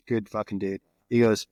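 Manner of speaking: ordinary delivery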